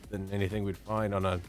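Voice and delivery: normal talking voice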